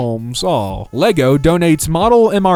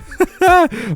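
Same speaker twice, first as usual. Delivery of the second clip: high laugh. Real, not acted. Delighted reaction to matching outfits.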